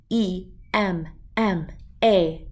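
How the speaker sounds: slow letter by letter; spelled out. Shown as a demonstration. Teaching a dog to spell, letter by letter slowly.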